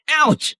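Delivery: high "ouch" of pain; pained yelp. Acted. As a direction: yelp from a knock on the head. Bumped heads hard.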